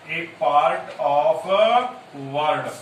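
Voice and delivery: write-along pace, each word separate; dictating while writing. Shown as a demonstration. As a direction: draw out "a part of word" word by word. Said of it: "मैं यहां लिख देता हूं".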